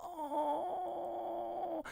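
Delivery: a soft, choked, crying "oh"; a real little sob of an oh; tearful sigh. Real, not acted. Overcome by the song, a soft crying sigh.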